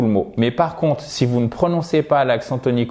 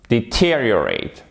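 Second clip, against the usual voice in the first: "deteriorate" as di-TEER-ee-uh-rate; stress on TER. Shown as a demonstration. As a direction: punch the second syllable of "deteriorate", falling after. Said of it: "vous mettez l'accent tonique ici".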